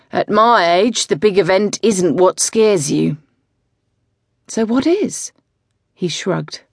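musing, mock-philosophical coach; weary older coach. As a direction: coach musing to his rider, lightly voiced and wry. Tom voiced throughout.

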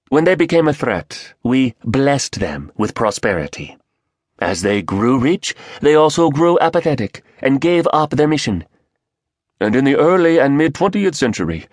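quick, clipped Korean speaker; Korean accent. Acted. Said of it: "a rapid-fire Korean voice answered".

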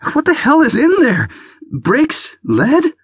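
high, loud, outraged cry. Acted. Hurt toe, she yells at him about his heavy bag. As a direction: hurl each guess at him in pain. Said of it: "she cried".

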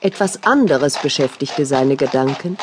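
ordinary delivery

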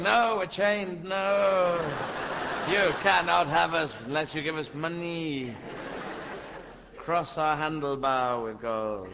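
slow, drawn-out refusal from a trolley; talking chained trolleys. Acted. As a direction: play a trolley stubbornly demanding payment. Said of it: "Whereas trolley's going".

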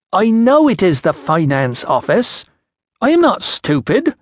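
put-on high, slow, affronted voice with a foreign accent; indignant Iceni accent. A narrator's reading. Iceni woman retorts to the finance official, indignant accent. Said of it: "trouble following your accent".

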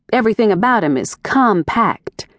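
usual speaking voice

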